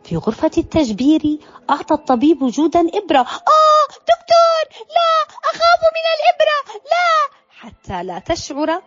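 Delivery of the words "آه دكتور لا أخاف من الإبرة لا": very high, fast, panicked squeal; terrified child. Acted. Jood facing the doctor's needle, panicked pleading.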